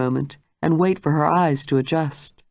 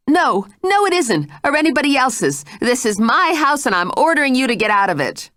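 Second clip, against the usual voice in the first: much higher, louder commanding voice; furious order; 9 semitones higher, 3 dB louder than usual. Acted. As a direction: order her out of the house. Jane orders her out.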